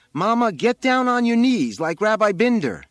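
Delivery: high, loud shouted order; curiously high voice. A narrator's reading. Boy on the roof ordering his mother to kneel, high shout. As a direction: shout it down in a high boyish pitch. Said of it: "in a curiously high voice".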